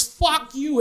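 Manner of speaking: high, loud mock outburst; imitated angry curse. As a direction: act out the irate customer storming off. Retold customer cursing the clerk.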